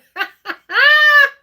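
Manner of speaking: shrieking high laughter; cackling high laugh; loud laugh. Real, not acted. Laughing at a memory.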